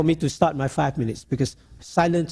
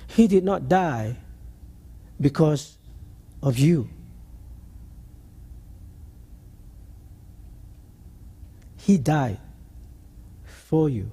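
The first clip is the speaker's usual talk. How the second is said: gentle reassurance; 4 dB quieter, slower than usual. Retold or imitated. Counselling grieving patient gently.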